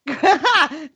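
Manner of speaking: ordinary talk, nothing marked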